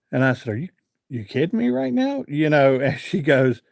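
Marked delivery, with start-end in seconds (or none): 0.5-2.2 high, disbelieving question; incredulous. Retold or imitated. Telling a stranger in line, high incredulous disbelief. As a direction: act stunned she refused.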